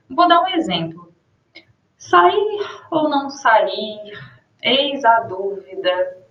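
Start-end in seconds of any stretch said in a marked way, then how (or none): 2.0-6.2 slow, drawn-out pondering; reflective pause. Shown as a demonstration. Teacher reading a pensive line to show ellipsis. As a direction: let the doubt hang, slowly. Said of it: "tem uma reflexão".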